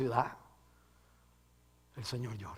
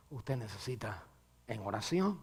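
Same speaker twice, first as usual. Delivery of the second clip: normal talking voice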